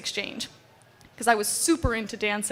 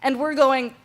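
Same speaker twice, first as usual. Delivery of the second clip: ordinary talk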